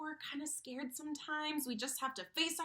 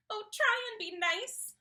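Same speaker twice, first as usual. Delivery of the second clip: whimpering; 7 semitones higher, 6 dB louder than usual. Acted. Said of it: "he whimpered".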